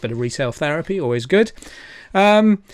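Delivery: ordinary talk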